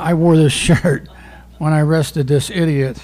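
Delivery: ordinary talk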